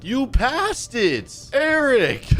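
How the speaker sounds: drawn-out scolding yell, climbing high; exasperated high call-out; yelling at the driver. Pretend. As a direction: holler it in mock exasperation. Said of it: "we're both yelling at this guy".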